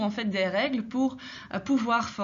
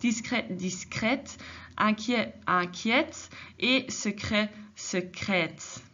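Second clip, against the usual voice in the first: slow, careful discret, discrète, inquiet, inquiète; teacher slowly modelling the accent grave; slow model; slower than usual. Shown as a demonstration. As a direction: spell the pairs out slowly. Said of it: "un accent grave".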